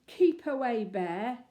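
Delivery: loud, theatrical warning; dramatic. Acted. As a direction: ham up the fear. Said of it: "he said dramatically".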